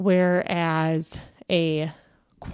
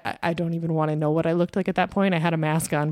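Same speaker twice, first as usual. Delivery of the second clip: normal talking voice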